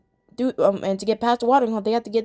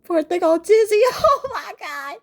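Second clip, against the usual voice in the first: laughing; 9 semitones higher, 3 dB louder than usual. Real, not acted. Laughing at dizzy cartoon.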